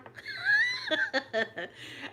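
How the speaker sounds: long high-pitched laugh; high, drawn-out amused laugh. Real, not acted. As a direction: laugh at the absurd outcome. Laughs about getting a complaint number.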